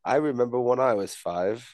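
put-on British storytelling; British accent. Answering the board game question, mock British. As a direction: begin a tale in mock British. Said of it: "we have to talk in a British accent".

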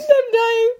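high breathless laughing; dying of laughter; laughing helplessly. Real, not acted. Overcome by laughter.

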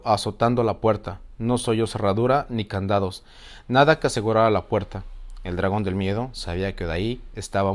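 storyteller reading a fairy tale; story narration. A narrator's reading. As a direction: read it like a bedtime story. Reads fairy tale aloud.

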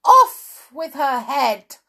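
slow, high, full-force royal shout. A narrator's reading. Queen ordering Alice's execution, drawn-out shout. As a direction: bellow each word at the top of your voice. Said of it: "shouted at the top of her voice".

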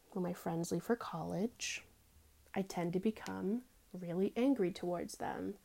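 whispered and soft. Real, not acted. She confesses about friends leaving, whispered. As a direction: hush it like a confession.

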